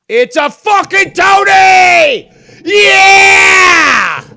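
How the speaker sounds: very high, drawn-out full-force yell; triumphant shout. Real, not acted. Streamer finally hooking a Tony fish, triumphant shout. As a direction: scream it in victory, stretching each word.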